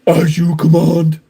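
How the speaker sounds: a hint of robotic Soundwave, even and loud; Soundwave impression. Soundwave answering Megatron's order, lightly robotic. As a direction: answer flatly like an obedient robot. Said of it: "was it Megatron or was it Soundwave".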